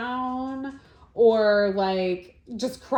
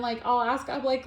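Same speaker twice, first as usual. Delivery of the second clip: usual speaking voice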